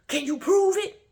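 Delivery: very high, loud indignant shout. Retold or imitated. Imitating him demanding proof, loudly indignant. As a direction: yell it as an outraged challenge. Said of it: "he gets indignant".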